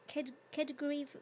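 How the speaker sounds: halting attempt at Kedgariv-, broken off; stumbling over the word. Real, not acted. Reading a viewer's comment aloud, stumbling over a name. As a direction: sound out Ked- then Kedgariv- unsure. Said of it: "how do you say that?".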